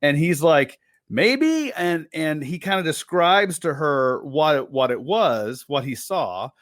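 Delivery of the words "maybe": high, unsure mumble; high hesitant maybe. Retold or imitated. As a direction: hedge it up high. His reply retold.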